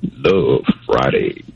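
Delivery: slow, low baritone drawl; deep baritone. Pretend. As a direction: drop into a big deep voice. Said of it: "in that deep baritone voice of yours".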